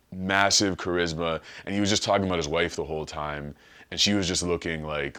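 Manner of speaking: ordinary delivery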